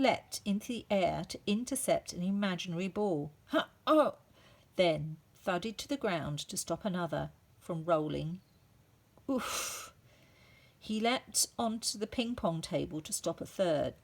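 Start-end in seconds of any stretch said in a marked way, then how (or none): 3.9-4.2 effort cry. A narrator's reading. Leaping teacher. 9.3-9.9 winded oof. A narrator's reading. Thudding to ground.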